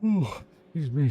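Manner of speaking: sleepy yawn through the words. Real, not acted. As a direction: say it mid-yawn, drowsy. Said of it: "I'm falling asleep".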